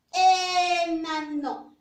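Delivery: slow, loud teacher model of enano; slow model word. Shown as a demonstration. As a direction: stretch out enano for the class. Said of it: "¿Inicia con la vocal E".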